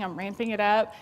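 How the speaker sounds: usual speaking voice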